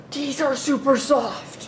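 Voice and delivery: slow, sinking into a soft chair; drawn-out, blissed-out; lazy comfy sigh. Retold or imitated. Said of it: "and just go".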